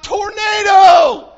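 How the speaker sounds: high, loud, drawn-out scream. Retold or imitated. Second prisoner screaming to distract the squad, slow loud scream. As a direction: stretch it out as a frantic alarm. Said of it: "screams out".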